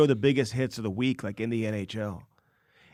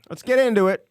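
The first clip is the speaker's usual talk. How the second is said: mock rallying cry; 10 semitones higher, 7 dB louder than usual. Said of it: "rally behind me".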